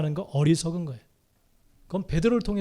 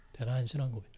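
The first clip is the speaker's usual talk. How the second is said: 대단한 신앙 고백 repeated much softer and lower; soft echo; 6 semitones lower, 10 dB quieter, faster than usual. Acted. Preacher echoing his own praise to the congregation, hushed. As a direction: let the repeat sink to a hush. Echoed softly after first take.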